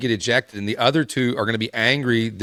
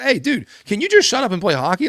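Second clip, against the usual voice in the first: much higher, half-laughing exasperated plea; exasperated retold plea; 10 semitones higher, 3 dB louder than usual. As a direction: beg the teammate to just play, lightly hammed. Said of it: "and I said".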